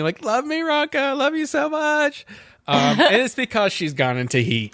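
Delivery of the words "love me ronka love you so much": very high, squealed imitation of lovesick dogs; mock yelling. Host voices the dogs chasing Ronka, mock yelling. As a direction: yelp it in falsetto without real volume. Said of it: "like, yelling".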